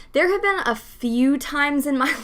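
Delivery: usual speaking voice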